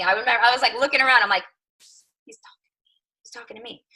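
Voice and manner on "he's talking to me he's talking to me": hushed, breathless whisper to herself; awed whispered disbelief. Retold or imitated. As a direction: whisper it so nobody hears. Looking around at seminar.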